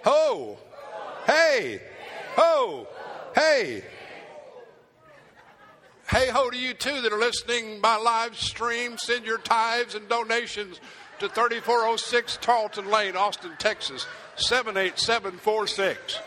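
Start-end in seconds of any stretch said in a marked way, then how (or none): 0.0-3.8 loud chanted hey and ho; preacher leading the congregation in a loud chant; call and response. Real, not acted. 9.0-16.2 joking to the livestream viewers, a hammed-up donation appeal; mock TV commercial. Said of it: "Sorry for the commercial break".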